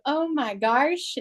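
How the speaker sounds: lightly mocking their own churchy voice; sweet churchy exclamation. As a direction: gush it like a sweet church girl.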